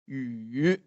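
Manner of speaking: "Yu" dipping then rising; third tone. Shown as a demonstration. Teacher demonstrates Chinese third tone on one syllable, dipping. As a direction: bend "Yu" down and up, questioning. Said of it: "speaking the Chinese third tone".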